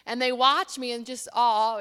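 ordinary delivery

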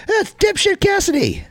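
very high, drawn-out name-calling; imitated high-pitched heckle; mock outburst. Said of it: "what did he call Orange Cassidy".

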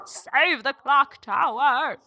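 lightly mimicked, drawn-out street-campaigner call; imitated campaigning woman. Mimicking the woman shaking cans for donations, slow mock plea. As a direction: stretch it out slowly, mock fundraising plea. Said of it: "This woman".

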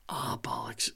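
breathy muttered bollocks; whispered curse. Real, not acted. Forgetting a name, he whispers to himself. Frustrated aside, can't recall name.

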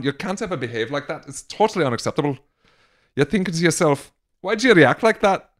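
put-on lightly done Scouse accent, Carragher style; a hint of a Liverpool pundit voice. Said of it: "in the Jamie Carragher accent".

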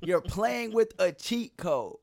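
loud, slow disbelieving gripe; exasperated complaint. Real, not acted. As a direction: stretch it out in disbelief. Complaining about friend's gaming win.

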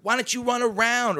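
high, loud mock complaint; heckling fan. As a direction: holler advice from the stands, mimicking her. Mimicking lady heckling a play.

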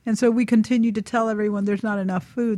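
ordinary talk

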